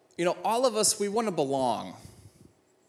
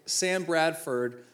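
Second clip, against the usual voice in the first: normal talking voice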